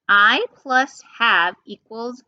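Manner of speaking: slow, deliberate contraction demo; slow model read. Shown as a demonstration. Teacher slowly modeling I've for students to repeat. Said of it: "You give it a shot".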